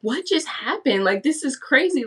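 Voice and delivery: a touch of bewildered amazement; retold shock. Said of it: "I was in shock".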